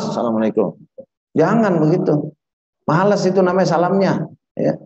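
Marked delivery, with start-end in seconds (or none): 0.0-0.8 hurried, low, half-hearted greeting; lazy salam. Shown as a demonstration. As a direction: toss off the salam lazily, dropping in pitch. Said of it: "jadi salam jangan males".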